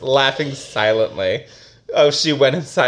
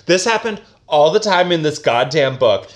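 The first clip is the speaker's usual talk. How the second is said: ordinary talk, nothing marked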